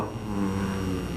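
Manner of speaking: long, very low "hmm"; pondering hum. Acted. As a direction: hum low as the brain starts thinking. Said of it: "your brain starts to go".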